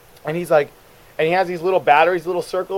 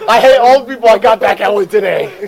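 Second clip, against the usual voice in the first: loud, high pretend boast; mock gloating; 6 semitones higher, 9 dB louder than usual. Friend playing the clerk who fooled an old customer, loud mock gloating. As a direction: crow over a petty victory.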